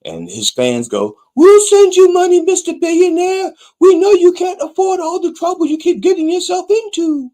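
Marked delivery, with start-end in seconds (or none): 1.4-7.3 very high falsetto, louder; squeaky mock fan voice pleading to give money; mock adoring fan. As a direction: gush like a starstruck fan in falsetto. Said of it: "that's how they sound".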